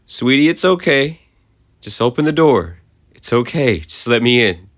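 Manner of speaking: coaxing mother. A narrator's reading. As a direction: coax her to open the door. Mother coaxing to open door.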